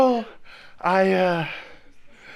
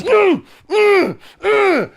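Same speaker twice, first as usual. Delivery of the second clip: mugger's grunt; 7 semitones higher, 7 dB louder, much slower than usual. Retold or imitated. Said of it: "And he goes".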